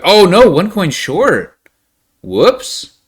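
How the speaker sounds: loud drawn-out dismay, slowed; oh no, one coin short, loud and pained; dismayed groan. Real, not acted. Dismay at a near miss.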